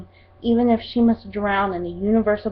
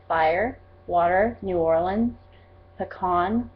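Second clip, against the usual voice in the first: normal talking voice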